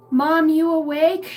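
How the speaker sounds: put-on high, loud, drawn-out little-kid call; toddler daughter. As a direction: call out to Mom like a two-year-old. Acts out her two-year-old daughter.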